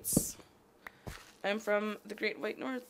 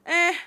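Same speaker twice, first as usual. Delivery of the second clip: "eh" as a short, raised, loud reaction; standalone EH; 9 semitones higher, 11 dB louder than usual. Shown as a demonstration. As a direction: toss out "eh" alone, punched high. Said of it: "I say it like".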